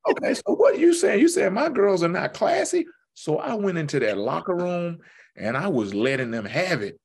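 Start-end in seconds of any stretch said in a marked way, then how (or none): 0.1-2.8 high, loud, fast offended comeback; bristling at the ref; indignant retort. Retold or imitated. Snapping back at the ref.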